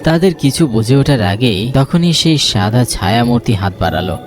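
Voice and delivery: plain reading voice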